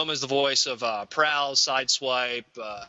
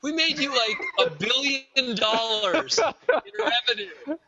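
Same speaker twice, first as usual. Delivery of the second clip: indignant rant; 6 semitones higher than usual. Retold or imitated. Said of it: "And I was just like".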